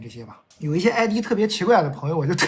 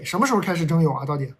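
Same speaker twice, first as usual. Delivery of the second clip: ordinary talk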